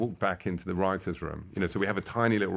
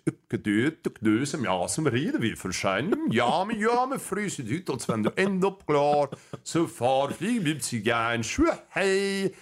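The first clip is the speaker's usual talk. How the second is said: mock Swedish; 6 semitones higher than usual. Pretending to speak Finnish to the guest, mock Swedish gibberish. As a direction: fake Swedish gibberish, higher and bouncy. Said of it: "I just start speaking Swedish".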